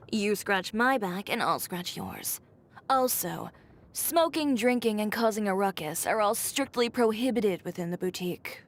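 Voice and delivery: ordinary talk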